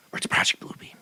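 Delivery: hushed, secretive whisper; conspiratorial whisper. Acted. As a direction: let it slip like a secret. Conspiracy aside, dropped to whisper.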